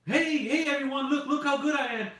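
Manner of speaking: high, loud mocking brag; imitating a self-congratulating shout; mock attention-seeking scream. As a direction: call out to everyone like a show-off. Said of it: "it screams".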